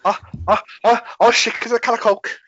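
Scouse accent. Shown as a demonstration. Showing off one line in Scouse. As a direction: pitch it up into a Scouse impression. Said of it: "I can say one thing as a Scouse".

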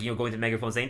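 normal talking voice

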